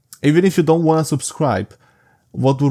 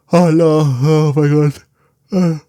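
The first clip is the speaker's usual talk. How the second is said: real yawn, louder and higher; 4 semitones higher, 5 dB louder, slower than usual. Real, not acted. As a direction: exclaim mid-yawn, stretched out. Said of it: "I'm yawning again".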